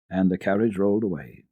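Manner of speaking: ordinary delivery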